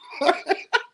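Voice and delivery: sudden high laugh; high burst of laughter. Real, not acted. Laugh after the punchline.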